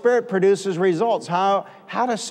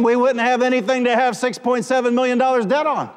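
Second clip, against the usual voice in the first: raised-pitch insistence, peaking on key phrases; emphatic preaching; 4 semitones higher than usual. Real, not acted. Preacher defending giving millions away, emphatic preaching. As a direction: proclaim it forcefully to the whole room.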